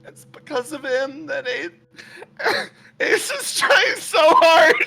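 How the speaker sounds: tearful yell. Acted. Woman yelling through tears about Ace's suffering. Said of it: "She yells".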